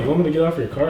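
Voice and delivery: indignant. Retold or imitated. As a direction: push back at the rude girl. Retelling girl wanting him out.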